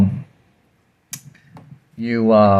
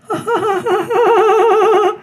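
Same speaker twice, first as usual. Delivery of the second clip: high-pitched giggle in a tiny voice; high put-on laugh. Shown as a demonstration. Ending the compressor demo, high silly laugh.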